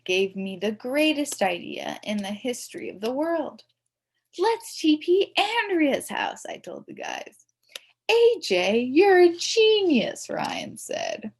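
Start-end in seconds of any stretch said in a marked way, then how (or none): 4.4-6.5 excited plan. A narrator's reading. A J announces his great idea. 8.1-10.3 excited praise. A narrator's reading. Ryan praises the idea.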